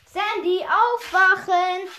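loud, drawn-out annoyed wake-up call. Acted. Pam waking Sandy up, annoyed loud call. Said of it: "sagte Pam genervt".

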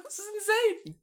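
high squeaky outraged cry, well above his voice; mock outrage. Reacting to police charged for missed shots, high squeaky disbelief.

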